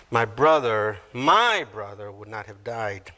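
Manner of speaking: low reading, second "my" lifted out; stress on MY. Shown as a demonstration. Martha to Jesus, possessive stress on "my". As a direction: repeat it, leaning hard on "my". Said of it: "Martha places the emphasis on my".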